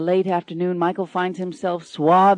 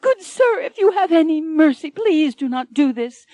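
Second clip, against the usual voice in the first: much higher, louder desperate plea; terrified cry; 9 semitones higher, 6 dB louder than usual. Acted. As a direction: cry out for mercy in terror. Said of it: "Anne cries out, terrified".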